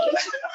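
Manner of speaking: quick amused giggle; bright little laugh; high laugh. Real, not acted. Laughing at friend's remark.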